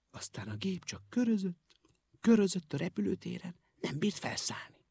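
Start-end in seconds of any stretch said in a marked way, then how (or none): none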